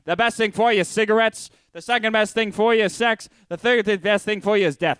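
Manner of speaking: Mid-Atlantic carnival barker pitch; Mid-Atlantic accent. Pretend. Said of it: "best Mid-Atlantic impression".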